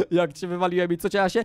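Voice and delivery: normal talking voice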